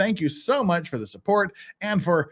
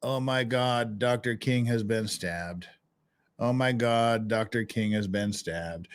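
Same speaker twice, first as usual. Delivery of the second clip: slow, low, softer mock panic; mock deadpan crowd; 5 semitones lower, 5 dB quieter, slower than usual. Streamer imitating bystanders at the stabbing, utterly deadpan. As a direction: drone it unbothered, as if nothing happened. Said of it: "a group of people standing around going".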